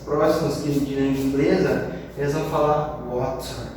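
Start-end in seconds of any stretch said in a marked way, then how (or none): 3.1-3.7 water with a clean, crisp T; British water. Shown as a demonstration. Teacher shows the British water, crisp T. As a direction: say the T in water neatly, British style. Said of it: "pronunciar esse T bem bonitinho".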